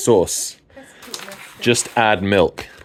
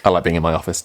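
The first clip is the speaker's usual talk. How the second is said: ordinary talk, nothing marked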